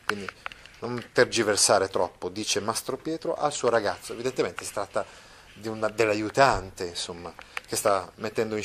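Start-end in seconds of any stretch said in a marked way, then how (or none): none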